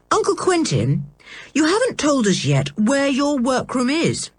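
pressing eagerly, lightly voiced; determined curious boy. A narrator's reading. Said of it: "quite determined to know".

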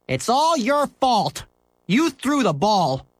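slow, high accusing whine; angry boy. Acted. Scooter blames Henry for the lost ball, high and angry. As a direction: blame him for everything.

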